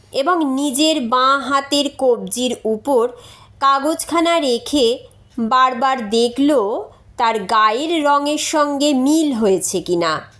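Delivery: ordinary delivery, nothing marked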